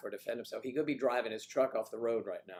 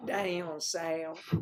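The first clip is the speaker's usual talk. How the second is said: higher, hammed-up Southern drawl; exaggerated Southern accent; 5 semitones higher than usual. Host playfully echoes guest's line, exaggerated Southern accent. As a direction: stretch both words into a mock Southern twang. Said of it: "do a, uh, a Southern accent".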